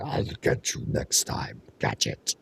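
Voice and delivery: lightly voiced cartoon villain threat; Doctor Claw impression. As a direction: hiss it like a cartoon baddie. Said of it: "like Doctor Claw here".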